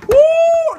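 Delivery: sudden high whoop; high mocking holler. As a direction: whoop like the thugs cheering. Mocking thugs laughing.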